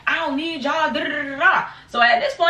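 ordinary talk

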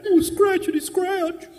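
very high, loud, laughing squeak; high squeaky voice. Retold or imitated. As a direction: squeak it like a big-bearded man. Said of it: "the higher your voice should go".

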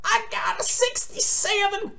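squeaky despair. Retold or imitated. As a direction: squeal the score as if it hurts. Sees bad test result.

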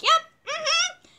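very high, squeaky assent; pained agreement. Retold or imitated. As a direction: squeeze it out high through the pain. Acts her pained agreement to doctor.

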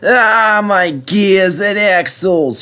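high, slow groan of effort; groaning bus. A narrator's reading. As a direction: strain up the hill, dragging the words. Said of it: "he groaned".

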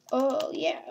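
normal talking voice

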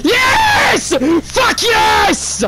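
high, loud victory yell; shouting yes at the screen; triumphant shout. Real, not acted. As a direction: cheer the win at full voice. Wins the fight.

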